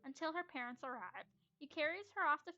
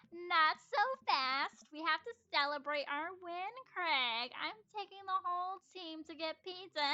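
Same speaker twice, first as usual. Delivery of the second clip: pushy mom; 4 semitones higher, 6 dB louder than usual. Acted. Pushy mom interrupts Craig.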